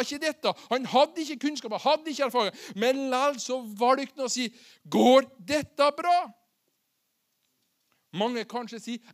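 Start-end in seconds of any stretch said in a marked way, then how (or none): 4.9-6.2 loud, slow, rising question; acted raised-voice doubt; emphatic doubting question. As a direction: throw the doubt out loudly, word by word.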